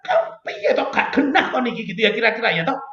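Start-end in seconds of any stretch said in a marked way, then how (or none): 0.0-1.8 exasperated, higher complaining outburst; angry at God. Retold or imitated. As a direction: scold God in frustration. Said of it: "Saya tuh sampai marah".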